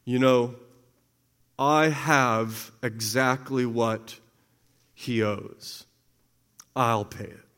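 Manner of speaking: kind friend. Acted. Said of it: "my friend came and says".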